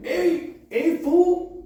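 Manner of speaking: high, loud street-guy call. Acted. Friend playing a homeboy from the neighborhood, loud.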